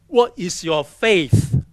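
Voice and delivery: a hint of a menacing interrogator; stern demand. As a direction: drag out each word of the challenge.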